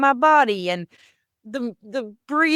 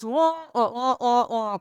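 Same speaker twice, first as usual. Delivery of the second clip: muffled wah-wah garble; garbled grown-up droning, words barely getting through; Charlie Brown's mom; 2 semitones higher than usual. Retold or imitated. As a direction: talk in indistinct trombone-like wahs. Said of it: "when Charlie Brown's mom would talk".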